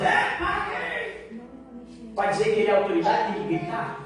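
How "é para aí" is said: mock shouting cop. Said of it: "ficar gritando que nem um doido".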